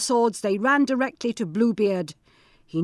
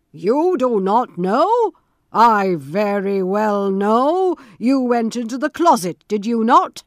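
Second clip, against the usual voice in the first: menacing Bluebeard; 7 dB louder than usual. Acted. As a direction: drag out each word to threaten her. Bluebeard accuses his wife.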